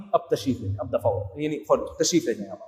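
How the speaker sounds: sarcastic host quietly wanting the guests gone; mock dismissal. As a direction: mutter the guests out the door.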